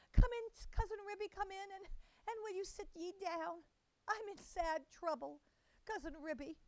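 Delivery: high, tearful fretting mother-cat voice. A narrator's reading. Tabitha greets her cousin in tears, high and tearful. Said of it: "shedding tears".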